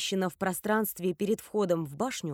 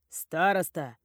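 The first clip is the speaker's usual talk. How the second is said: loose, cheeky call; Grog; 3 semitones lower, slower than usual. A narrator's reading. Cheeky friend waking the class monitor, brash. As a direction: call out with easy impudence. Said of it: "развязный голос Грога".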